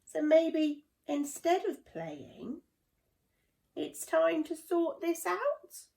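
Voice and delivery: slow, high, careful start; cautious Ollie. A narrator's reading. Ollie to his friends, slow and cautious. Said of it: "began Ollie cautiously".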